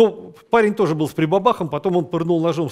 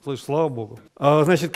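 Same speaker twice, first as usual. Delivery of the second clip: normal talking voice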